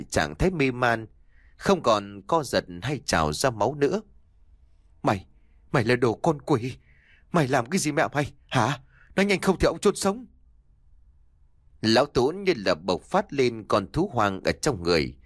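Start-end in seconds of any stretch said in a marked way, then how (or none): 5.0-10.3 fast, rising furious demand; furious threat. A narrator's reading. Father threatens his daughter, fast and furious. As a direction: threaten her, pushing higher.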